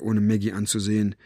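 usual speaking voice

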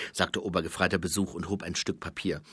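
usual speaking voice

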